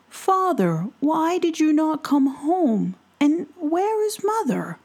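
put-on a boy begging to know; pleading young boy. Pell questions his father.

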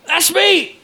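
high-pitched drunken-night excitement, reenacted; excited outburst. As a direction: squeal it out, retelling your own amazed reaction. Retells own drunken excited outburst.

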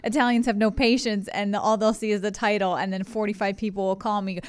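ordinary talk, nothing marked

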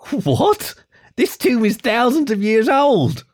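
high, slow disbelieving fox; incredulous. Acted. Fennec's disbelief.

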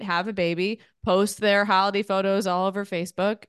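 ordinary talk, nothing marked

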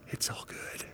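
hushed, unvoiced whisper; real quiet. Shown as a demonstration. Speaker reassuring the audience as friends, real quietly. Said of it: "I'm gonna talk real quietly with you".

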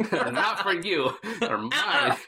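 high, laughing mock voice, ending in a rising squeal; hammed-up possessive squeal through a laugh; greedy childish claim. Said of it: "He's like".

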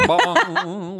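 high, resonant "bong" noise; imitated bong. Host describing rings when it hits the plate, imitated bong. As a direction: sing out a ringing bong.

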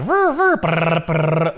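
imitated drill buzz; power tool noise. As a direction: buzz like construction tools.

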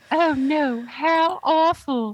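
high, slow British little-girl impression; Peppa Pig. Mimicking Kat's reading of the letter, British little-girl voice. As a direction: mimic a dainty English child. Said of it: "Peppa Pig".

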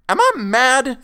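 very high, loud outraged shout; thundering. A narrator's reading. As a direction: terror turning into rage. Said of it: "thundered Mister Bolton".

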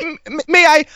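shy would-be-polite coffee order, high and hesitant; Ben Carson Alan Keyes voice. Retold or imitated. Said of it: "my Ben Carson, Alan Keyes voice".

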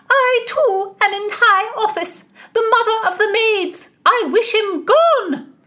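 high, loud indignant shriek; apoplectic. A narrator's reading. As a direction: screech it in a fury of wounded authority. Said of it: "a bit of an apoplexy".

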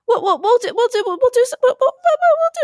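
rising stammer. Real, not acted. Stammering, pitch climbing.

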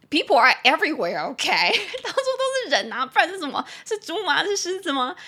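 loud, high, laughing sarcasm; mock the obvious statement, laughing; laughing mockery. Mocking "there are people. (大笑着夸张嘲讽)

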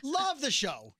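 high, pretend starstruck praise; mock gushing fan. Mock gushing fan reply to Rick.

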